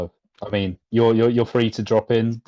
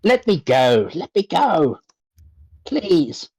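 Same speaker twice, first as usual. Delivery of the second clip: gnome voice; 6 semitones higher, slower than usual. Acted. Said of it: "I'll, I'll do it".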